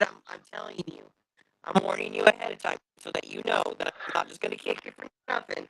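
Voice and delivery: flat, electronic robot voice. Acted. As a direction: warn him in a robot voice. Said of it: "you can't shout at him in a robot voice".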